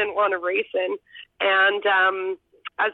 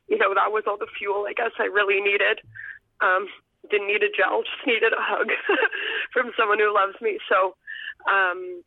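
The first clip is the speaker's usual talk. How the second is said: normal talking voice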